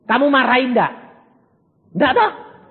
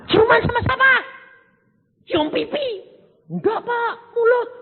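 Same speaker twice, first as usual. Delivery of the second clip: shocked disbelief. Retold or imitated. Retold shocked dialogue with congregant.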